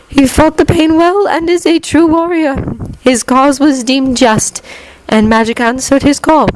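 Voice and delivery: high, measured otherworldly woman's voice; solemn wise Keeper. A narrator's reading. Keeper praising the fallen boy, high solemn voice.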